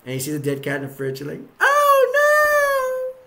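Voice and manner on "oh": very high, loud, drawn-out mock cry; hammed-up horrified wail. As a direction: wail it in over-the-top horror. Reacts to seeing dead cat.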